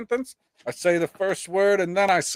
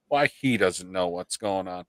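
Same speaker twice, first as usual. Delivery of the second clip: usual speaking voice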